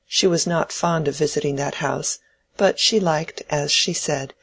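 usual speaking voice

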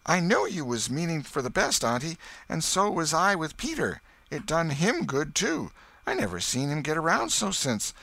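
put-on high boy's voice with a twinkle; sly boy. A narrator's reading. Boy answers his aunt, high and sly. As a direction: tease her back, sly kid.

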